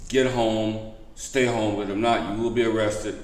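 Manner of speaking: normal talking voice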